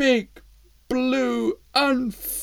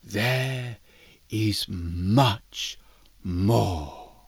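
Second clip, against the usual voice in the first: deep mysterious voice; 12 semitones lower, 4 dB quieter, much slower than usual. Acted. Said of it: "said a voice above them".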